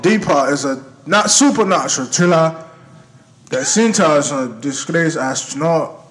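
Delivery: light put-on British accent reading a blurb. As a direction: read it in a playful British accent. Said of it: "You sound African and British".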